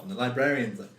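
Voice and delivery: ordinary talk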